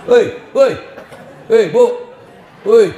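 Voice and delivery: loud call for attention; hey, get the ladies' attention. Real, not acted. Preacher calls out to the women loudly. Calling out to audience.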